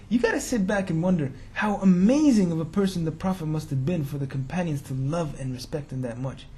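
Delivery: usual speaking voice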